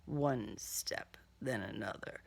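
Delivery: low whispered refrain. A narrator's reading. Narrator counting his steps from hiding, whispered. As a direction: whisper each step as he closes in.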